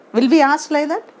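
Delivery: usual speaking voice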